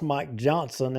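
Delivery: usual speaking voice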